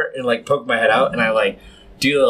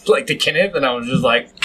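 ordinary delivery

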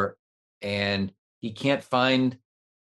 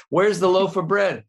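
louder repeat; 7 semitones higher, 7 dB louder than usual. Acted.

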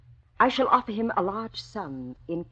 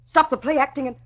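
higher, louder, rising alarm; angry demand; 6 semitones higher, 7 dB louder than usual. Acted. Woman demands the intruder stop play acting, loud and alarmed. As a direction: cut through the silence.

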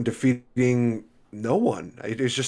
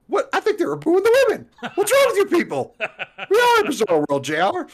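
high, squealing mock commentator, laughing through it; Jerry Lawler impression; 6 dB louder than usual. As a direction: squeal it like an outraged wrestling announcer. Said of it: "Jerry Lawler's just like".

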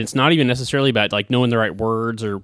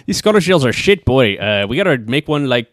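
put-on botched Scottish accent; 3 semitones higher, 4 dB louder than usual. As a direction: imitate a Scot badly, veering Irish. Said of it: "this is Irish".

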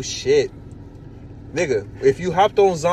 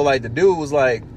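ordinary talk

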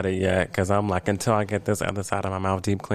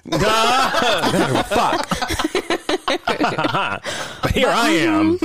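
laughing; 12 semitones higher, much slower than usual. Real, not acted. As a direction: laugh through the complaint. Laughing about gas money.

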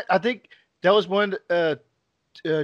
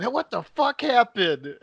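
slow disbelief; slower than usual. Retold or imitated. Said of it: "John's like".

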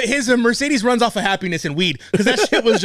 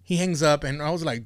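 ordinary talk

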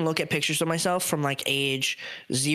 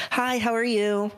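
higher, slow, overly polite phone voice; sweet put-on politeness for strangers; customer service voice; 8 semitones higher, slower than usual. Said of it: "because I do the stupid".

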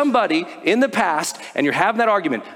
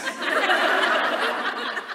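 breathy silent laugh; 5 dB quieter than usual. Real, not acted. Laughs remembering the chickens.

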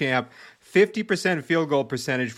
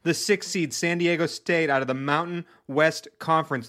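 ordinary talk, nothing marked

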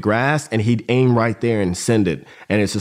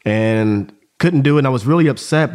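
ordinary delivery

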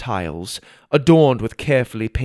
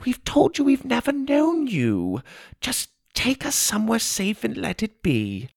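much higher, soft pleading mother voice; 13 semitones higher, 4 dB quieter than usual. Acted. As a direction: beg him to let it be. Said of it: "Ma pleaded".

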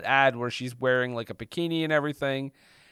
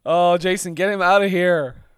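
mock ejection; 4 semitones higher, 8 dB louder than usual. Playing Jimmy throwing someone out.

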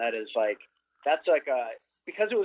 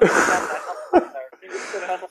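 laughing; 3 semitones higher, 8 dB louder than usual. Real, not acted. Laughing at own escape story.

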